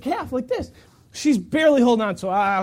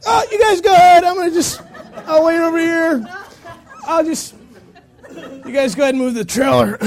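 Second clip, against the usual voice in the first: louder, higher, laughing pained bow-out; laughing through pain; 6 semitones higher, 5 dB louder than usual. Retold or imitated. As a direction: laugh off the hurt and give up. Hurt after the cow's kick.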